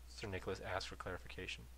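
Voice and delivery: ordinary delivery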